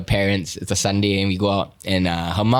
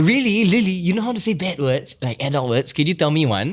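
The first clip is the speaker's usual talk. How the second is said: high-pitched delighted grandma imitation; mock-excited grandmother, pitch jumping up; 6 semitones higher than usual. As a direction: coax the child with exaggerated eager interest. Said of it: "the mother was excited".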